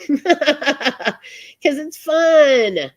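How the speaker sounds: laughing it out, high and loud; drawn-out giggly delivery. Real, not acted. Host laughing to audience about her fun hobby, giggling. Laughing over hog-tying joke.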